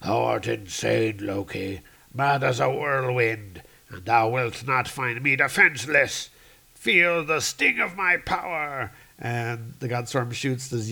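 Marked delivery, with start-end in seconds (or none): 0.0-8.9 defiant storm god. Acted. Storm god defies traitor.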